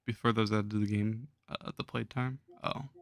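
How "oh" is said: ordinary talk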